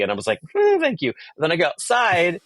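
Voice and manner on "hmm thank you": tiny, very high mock thanks; flattered squeak. Guest recognised from TV by an actor, flattered. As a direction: squeal a coy little thank-you. Retold reply to being recognised.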